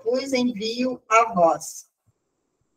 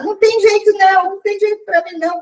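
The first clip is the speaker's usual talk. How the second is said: put-on crying girl; 7 semitones higher, 5 dB louder than usual. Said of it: "ela começou a chorar".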